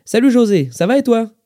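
high, loud hello; greeting back. Acted. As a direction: return a greeting across the shop. Customer greets the manager back.

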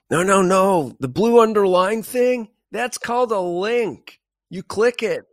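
put-on high-pitched, loud American lady accent, slowing on the link line. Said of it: "That's a great accent".

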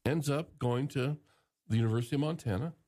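ordinary talk

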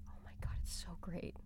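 very soft, breath-only marvelling; hushed awe. Real, not acted. Fan gushing over the Lion King trailer, hushed awe. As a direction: gush in a real whisper.

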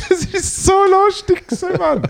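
very high squealing laugh. Real, not acted. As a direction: squeal with laughter through the words. Laughing at own stripping story.

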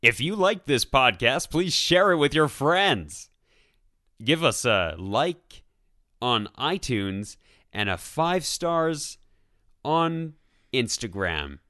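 loud, slow hammed-up announcer. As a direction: sell it like a radio ad. Over-the-top outro pitch.

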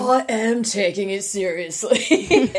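slow laid-back surfer drawl. Retold or imitated. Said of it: "Brought out the surfer voice".

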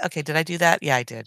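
normal talking voice

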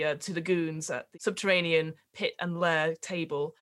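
normal talking voice

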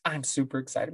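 normal talking voice

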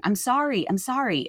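imitating a daughter's snarky non-apology. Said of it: "real snarky".

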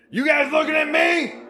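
high, loud yelling challenge; retold shout. Teen yelling at the staring crowd, loud shout. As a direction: bark it out at the whole room. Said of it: "I mildly remember shouting".

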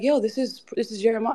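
high-pitched quoted introduction; imitating Six. Guest retelling Six introducing Jeremiah, high imitated voice. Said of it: "Six is like".

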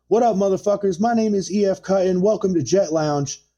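high, pumped club DJ announcing over the beat; imitating a hype DJ welcoming the room; hype DJ shout-out. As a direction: greet the crowd like a DJ on the mic. DJ hyping crowd over record.

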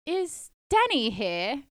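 put-on high, drawn-out British accent. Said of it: "I put on a British accent".

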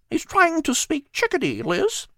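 high, chirpy bird voice; a tiny bird listing its visitors; little bird Pip. Acted. Bird Pip answering the cat in a high chirpy voice.

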